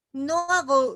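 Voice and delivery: usual speaking voice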